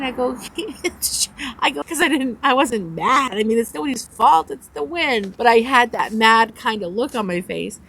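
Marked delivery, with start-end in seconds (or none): none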